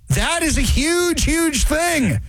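HUGE stretched high and slow; drawn-out emphatic huge; stress on huge. Real, not acted. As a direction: lean hard on huge, huge. Stressing how big it is.

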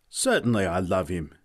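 a touch of heat, firm falling emphasis; understated indignation in the narration; mildly hot retort. A narrator's reading. Woman defending her love to Newt, mildly heated. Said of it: "she said hotly".

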